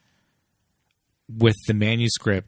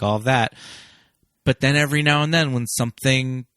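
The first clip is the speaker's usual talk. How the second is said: usual speaking voice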